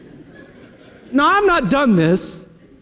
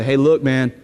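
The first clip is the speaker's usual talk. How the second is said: testimony voice; 9 semitones lower than usual. Retold or imitated. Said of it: "be a testimony to somebody and say".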